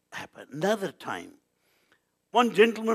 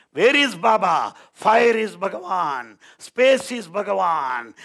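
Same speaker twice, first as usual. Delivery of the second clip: loud, raised preaching; forceful proclamation; 4 semitones higher, 8 dB louder than usual. Real, not acted. Preacher drives home the miracle to the listeners, loud proclamation. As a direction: ring out each statement to the whole hall.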